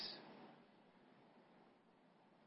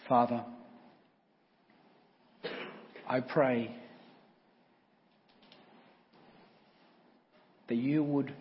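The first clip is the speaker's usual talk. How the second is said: prayer; slower than usual. Real, not acted. Pastor leads prayer.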